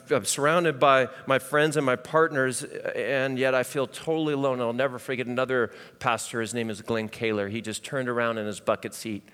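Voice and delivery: ordinary talk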